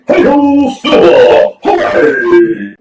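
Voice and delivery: high, loud cowboy whoop; Lone Ranger cry. Retold or imitated. Mocking the stampede runners, a cowboy yell. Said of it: "like the Lone Ranger saying".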